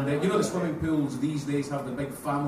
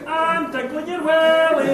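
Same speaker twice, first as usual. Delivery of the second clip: put-on higher, louder, slow toddler announcement; loud child voice; 11 semitones higher, 8 dB louder, slower than usual. Daughter announcing to the changing room, loud high slow. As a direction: announce it loudly like a proud kid. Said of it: "louder than I would have liked".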